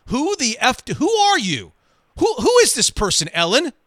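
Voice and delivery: high, loud furious yelling; drunken screaming rage. Retold or imitated. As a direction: scream it at the stranger in the booth. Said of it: "He started screaming at me".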